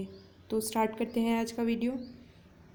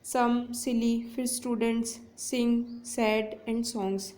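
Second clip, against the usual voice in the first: very slow, one word at a time; slow example read; much slower than usual. Shown as a demonstration. Teacher pointing out each s word for students, very slow. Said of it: "देखिए first में है".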